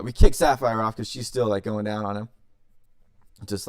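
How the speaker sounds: plain reading voice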